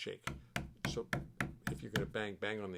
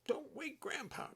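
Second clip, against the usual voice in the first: high-pitched mock scolding call, slowed; imitating a mom hushing her child from the next room; retold mommy yell; slower than usual. Grandpa retelling mom yelling at the boy, mock high call. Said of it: "And his mommy yelled".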